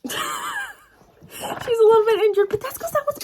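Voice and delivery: high, squeaky confession; a sheepish admission about the injured book; squeaky mock guilt. Real, not acted. Laughing at her own temper.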